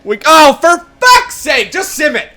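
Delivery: high, loud slow exasperated yell; furious drawn-out shout; frustrated outburst. Real, not acted. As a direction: explode at the screen. Losing, exploding in frustration.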